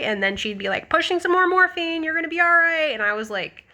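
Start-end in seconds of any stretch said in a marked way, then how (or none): 0.9-2.9 high-pitched nurse talking to a patient; imitated reassuring nurse.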